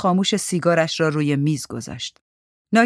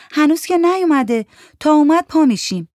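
Mandana's voice; 10 semitones higher, 6 dB louder than usual. Acted. Character Mandana's high voice.

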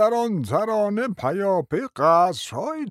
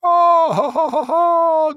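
long, drawn-out, higher and louder ah of rapture; delighted cry; 6 semitones higher, 6 dB louder, much slower than usual. Acted. Old prince crying out in delight to his nephew. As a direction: cry out in sheer joy. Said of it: "از روی وجد و سرور فریاد برآورد".

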